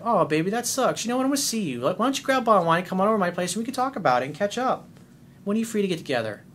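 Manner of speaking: sweet, charming, playful, quick invitation. Shown as a demonstration. Coach showing the client how to talk to his girl, playfully. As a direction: coax her over with easy charm. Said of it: "That's how you handle it, in that tone of voice".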